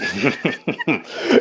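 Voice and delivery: high uncontrollable ghoul giggle; a giggle he can't hold back; creepy giggle. Acted. Ghoul forcing away his nervous tick, high giggling. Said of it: "an uncontrollable giggle".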